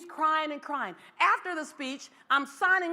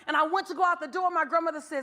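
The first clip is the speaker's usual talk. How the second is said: ordinary talk, nothing marked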